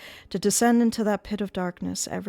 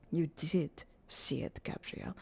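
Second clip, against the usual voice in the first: soft, unvoiced accusation; a quiet knowing whisper; 7 dB quieter, slower than usual. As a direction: hush it pointedly.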